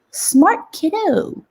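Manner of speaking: put-on high, slow praise for a clever kid; teacher praising a clever first grader, high and slow; impressed praise. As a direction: lift it up and stretch it, delighted. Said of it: "And I'm like".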